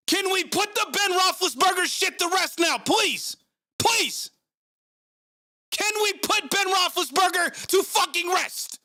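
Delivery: high, furious pleading rant; raging rant. Real, not acted. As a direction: vent pure frustration at the team. Raging stream rant at quarterback.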